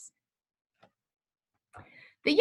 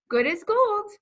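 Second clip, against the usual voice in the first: high, louder, bright doctor voice; playful doctor character voice; cheerful doctor; 11 semitones higher, 4 dB louder than usual. Acted. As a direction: pronounce him fit with a flourish. Doctor's verdict after bandaging.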